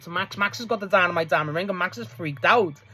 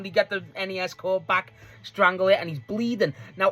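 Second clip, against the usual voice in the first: ordinary talk, nothing marked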